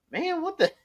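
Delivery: high mocking girl voice. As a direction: sneer it in a high voice. Voicing Angela's rebuff.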